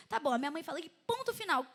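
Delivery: ordinary delivery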